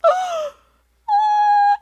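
very high, loud horrified oh; children's high gasping oh; shocked kids gasp. Retold or imitated. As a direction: kids seeing their balloon killed. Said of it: "they both are like".

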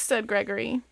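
lively bedtime-story read-aloud; reading to kids. Acted. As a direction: read it like you're sitting with your kids at bedtime. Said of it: "the same voice that I do for my kids".

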